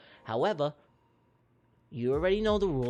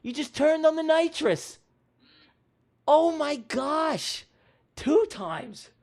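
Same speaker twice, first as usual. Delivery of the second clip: astonished race commentary; 11 semitones higher, 8 dB louder than usual. Pretend. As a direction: cry out in amazement at the surprise winner. Stunned commentary on surprise win.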